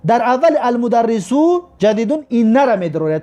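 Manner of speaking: usual speaking voice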